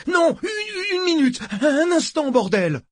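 high, loud panicked shout; panicked protest. Acted. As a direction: stammer out a desperate plea to wait. Pawn panics as he is grabbed. (cri de panique, très aigu)

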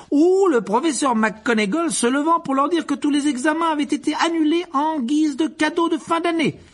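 high, breathless-with-joy storytelling; building excitement. A narrator's reading. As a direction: pile up each delight with mounting exhilaration. Narrator piles up the feast's joys.

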